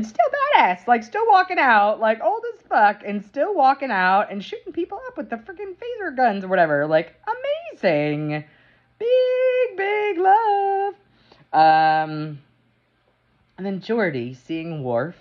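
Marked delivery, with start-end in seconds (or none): 0.0-6.8 high, fast fangirl gushing; gushing excitement. Real, not acted. Fan gushing to viewers about Riker, excited and high. 9.0-10.9 very high squeal of joy; squealing delight. Real, not acted. Fan reviewer gushing over the episode, squealing high.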